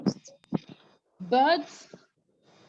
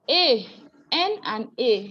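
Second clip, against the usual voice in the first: ordinary talk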